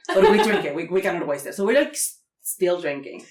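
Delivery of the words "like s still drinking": ordinary talk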